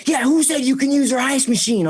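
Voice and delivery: squeaky high mock of the angry dad. Dad scolding him about the ice machine, mocked high.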